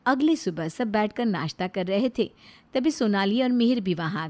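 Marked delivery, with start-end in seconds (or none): none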